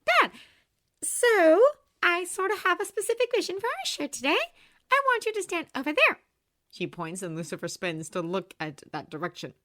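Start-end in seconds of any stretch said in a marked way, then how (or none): 0.0-6.2 put-on high, bright girl's voice; bubbly young woman; cheerful young woman voice. A narrator's reading.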